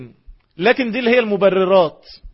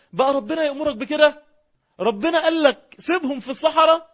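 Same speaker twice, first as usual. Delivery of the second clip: retold scream; 8 semitones higher than usual. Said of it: "تصرخ وتقول له".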